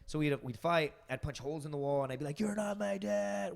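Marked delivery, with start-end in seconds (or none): 2.4-3.5 high, slow mock brat; a hammed-up angry teenager; bratty teen shout. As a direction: drag it out like a sulky kid yelling at his stepdad. Teen fighting stepdad.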